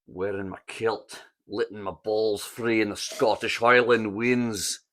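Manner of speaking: broad Scottish accent. Shown as a demonstration. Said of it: "Scottish is one of my weaker accents".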